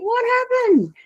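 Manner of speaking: mock alarm over rusty earrings; shocked. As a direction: jump up in pitch in shock. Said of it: "I'm like".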